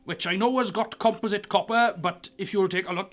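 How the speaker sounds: put-on a touch of pompous old sea dog correcting them; dragonborn wharf master. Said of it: "he leans forward".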